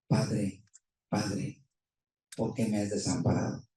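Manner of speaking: a hushed, slow, broken plea to the father; Jesus's quiet lament. Retold or imitated. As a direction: say it softly as the dying cry of the cross. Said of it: "que le dice".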